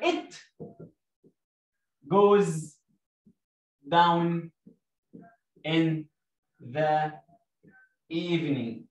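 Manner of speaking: slow, each word alone, falling toward the end; word by word dictation. Shown as a demonstration. Teacher dictating the second half to pupils, word by word. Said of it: "أحط كوما وأقول لك".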